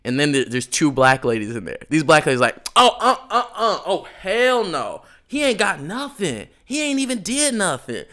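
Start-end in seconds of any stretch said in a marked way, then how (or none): 2.8-8.0 higher sassy voice, outraged for him. Retold or imitated. Retelling two shoppers defending him to the clerk, in a higher outraged voice. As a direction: pitch it up like two ladies taking his side.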